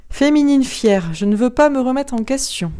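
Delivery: ordinary talk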